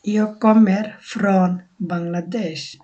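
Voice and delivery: very slow, careful Swedish sentence; slow model phrase. Shown as a demonstration. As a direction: spell it out slowly for learners. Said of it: "আমাদের বলতে হবে". (খুব ধীরে Swedish বাক্য)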